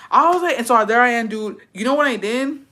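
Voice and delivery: ordinary talk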